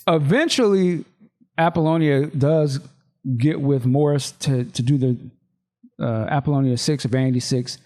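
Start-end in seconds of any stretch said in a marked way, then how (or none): none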